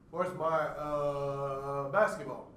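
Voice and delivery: long drawn-out "uh" before "basketball"; slow hesitation on "uh"; interjection example. Shown as a demonstration. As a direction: stretch the "uh", then land on basketball. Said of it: "one stuttered event".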